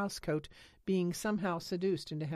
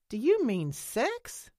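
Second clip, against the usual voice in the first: higher, louder, slow and startled; shocked slow question; 8 semitones higher, 7 dB louder, slower than usual. A narrator's reading. Nance guesses what embarrasses Lena, slow and startled. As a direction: say it like you can't believe it.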